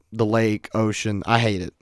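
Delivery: ordinary talk, nothing marked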